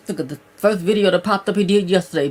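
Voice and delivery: usual speaking voice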